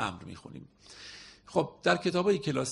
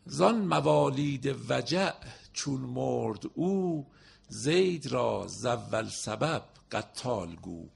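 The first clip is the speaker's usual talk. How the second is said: poem recitation; slower than usual. Acted. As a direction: declaim the couplet slowly. Recites verse.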